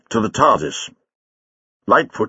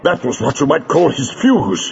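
a touch out of breath, pitched up; lightly winded after a struggle; gasped; 4 semitones higher than usual. A narrator's reading. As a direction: gasp it out, mildly. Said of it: "he gasped".

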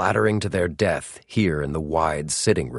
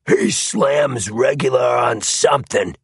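high, raised troglodyte voice; troglodyte thug; 7 semitones higher, 4 dB louder than usual. A narrator's reading. Second thug inspecting the bare arm, troglodyte thug voice. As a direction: call it out like a brutish thug, pitched high. Thug calls out his verdict.

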